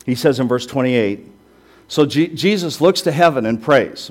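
ordinary delivery

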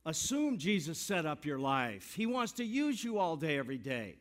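rally the congregation with each phrase; preaching at full force. Real, not acted.

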